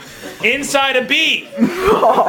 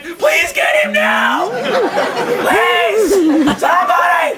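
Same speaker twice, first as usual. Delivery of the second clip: high, drawn-out mock cry for help; panicked pleading; 7 semitones higher, much slower than usual. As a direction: plead desperately, hammed up. [name] begs for help from clown.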